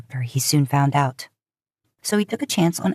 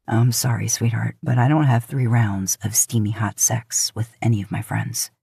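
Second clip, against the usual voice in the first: lower male love interest, teasing; 6 semitones lower than usual. Acted. Man teasing her about their nights, lower cocky voice. Dean teases her back.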